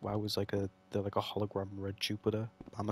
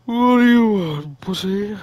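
much higher and louder, a mocking shout; taunting yell; 10 semitones higher, 18 dB louder, slower than usual. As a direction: bellow the taunt at the enemy. Yelling taunt at game enemy.

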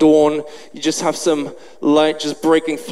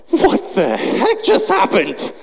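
slow, higher-pitched acted astonishment; stunned disbelief; 5 semitones higher, slower than usual. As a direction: drag it out in mock bewilderment. Disciples stunned by the miracle.

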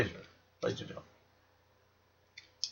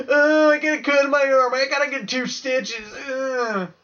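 much higher whining voice; whiny crybaby; 13 semitones higher, 3 dB louder than usual. Retold or imitated. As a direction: whine like a baby over a scratch. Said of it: "that one bully who bitches and complains".

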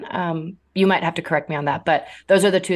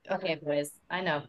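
hushed aside to the pets; soothing aside to dogs; 9 dB quieter than usual. Real, not acted. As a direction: drop softer to settle the dogs. Aside to her dogs mid-interview.

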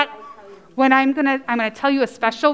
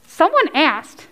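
ordinary talk

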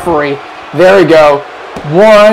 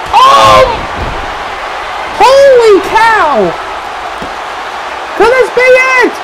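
excited commentator yell; 8 semitones higher than usual. Acted. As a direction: lose it over the kick-out. Kick-out surprise, shouting commentary.

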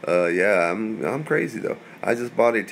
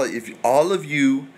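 ordinary talk

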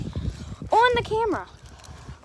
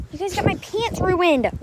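exasperated scolding; 7 semitones higher, 4 dB louder than usual. Real, not acted. Dogs jumping and muddying her.

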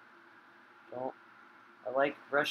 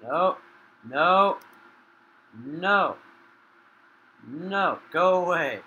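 loud, high, repeated no; panicked protest; 3 semitones higher, 8 dB louder, slower than usual. Real, not acted. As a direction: shout at the attacker in alarm. Fleeing an attacker in game.

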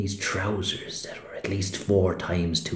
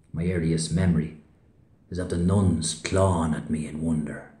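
ordinary delivery

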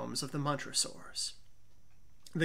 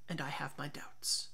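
whispered doubt; 4 semitones lower, 9 dB quieter than usual. Acted. Montresor hints his doubts.

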